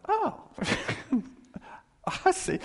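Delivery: high squeaky "oh, I see"; mock surprise. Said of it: "You go like".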